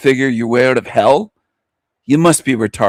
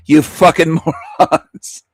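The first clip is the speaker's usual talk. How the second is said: laughing; 3 semitones higher than usual. Real, not acted. Laughing insult at viewers.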